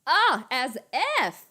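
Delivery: put-on drawn-out, loud Valley girl scoff; Cher from Clueless. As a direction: stretch it out like an indignant Valley girl. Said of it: "Doing the Cher".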